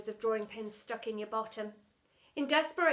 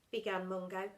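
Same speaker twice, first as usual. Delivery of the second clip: plain reading voice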